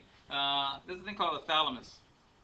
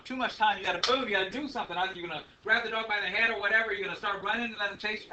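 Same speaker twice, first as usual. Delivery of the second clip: urgent alarm; 5 semitones higher, 9 dB louder than usual. Acted. Urgent fight or flight.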